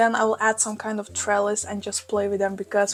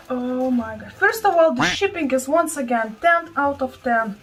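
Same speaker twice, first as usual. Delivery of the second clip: ordinary talk, nothing marked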